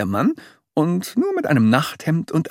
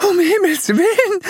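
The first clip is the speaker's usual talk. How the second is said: crying out; 5 dB louder than usual. A narrator's reading. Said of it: "rief Mama Dick".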